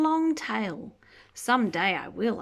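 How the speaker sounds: usual speaking voice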